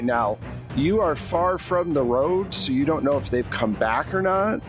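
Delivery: usual speaking voice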